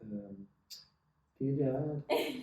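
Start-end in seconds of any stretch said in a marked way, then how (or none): 1.4-2.4 put-on Scouse accent. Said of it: "she said it in a Scouse accent".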